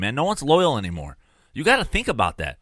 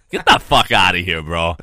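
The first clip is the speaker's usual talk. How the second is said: ordinary delivery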